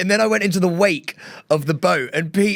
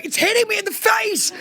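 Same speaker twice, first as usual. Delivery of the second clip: much higher, falling wail of complaint; mock panicked wail. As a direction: whine it like spray is in your face. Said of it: "Pete was going".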